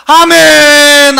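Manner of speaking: long-drawn, loud Amen; congregational amen. Acted. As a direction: cry it out as a whole crowd responding. People answer with raised hands.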